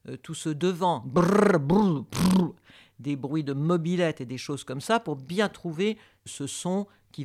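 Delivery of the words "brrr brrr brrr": loud rolling lip buzz like a moped; lip trill. Shown as a demonstration. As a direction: buzz your lips like a scooter. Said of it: "faire des résonances de bouche".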